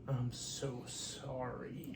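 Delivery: soft, very slow, drawn-out apology; imitated apologetic child. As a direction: quote a little girl's tender sorry. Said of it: "She goes".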